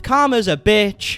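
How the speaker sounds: drawn-out theatrical lyric; mock-poetic recital. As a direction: deliver it like a weighty verse. Reading out lyrics as mock poetry.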